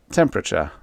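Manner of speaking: plain reading voice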